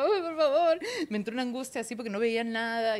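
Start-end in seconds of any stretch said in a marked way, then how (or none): 0.0-0.8 high, loud, whimpering plea; panicked plea. Retold or imitated. Pleading underwater in panic, high and crying. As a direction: beg as if drowning.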